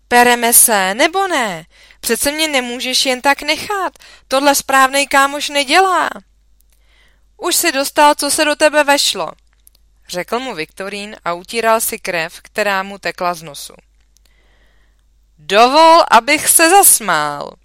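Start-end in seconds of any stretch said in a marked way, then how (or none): 0.1-6.1 raised, high voice of complaint; worked-up, higher and louder all through; indignant protest. A narrator's reading. Jáchym protests at his friend mid-fight. 7.4-9.3 high, loud comeback; raised voice, defiant; heated retort. A narrator's reading. Fighter retorts during a schoolboy brawl. 15.4-17.5 loud, high burst of scorn; raised voice, mock-offended; scornful retort. A narrator's reading.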